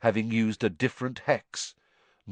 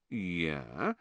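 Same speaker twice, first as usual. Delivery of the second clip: soft, low, drawn-out "yeah"; slow; 4 semitones lower, 8 dB quieter than usual. A narrator's reading. Harry and Ron recall the bet, slowly. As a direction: stretch it slowly, unsure. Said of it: "said Harry and Ron slowly".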